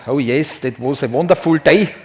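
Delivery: light mock Austrian accent; a playful Schwarzenegger-style Austrian accent; Arnold Schwarzenegger accent. Lecturer mimics Schwarzenegger for students, light Austrian accent. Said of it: "especially with his accent".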